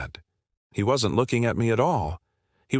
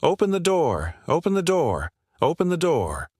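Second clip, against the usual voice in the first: mild mock scream, pitched up; retold scream; 7 semitones higher than usual. Man banging on the trucker's door, retold as a mild scream. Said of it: "banging on the side of my truck and screaming".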